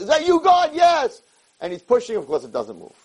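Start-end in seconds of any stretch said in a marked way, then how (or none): 0.0-1.2 high-pitched calling. Acted. Startled man and God call out.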